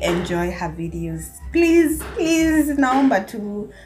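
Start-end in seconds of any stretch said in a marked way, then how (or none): none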